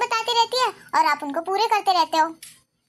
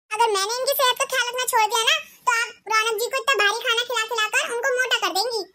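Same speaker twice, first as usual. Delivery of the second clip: put-on higher, sharper voice of a scolding young wife, quick in places; a bossy bahu telling off her mother-in-law; shrill daughter-in-law; 3 semitones higher, faster than usual. Ekta lectures mother-in-law. (सास को डाँटती तेज़ बहू, ऊँची आवाज़ में)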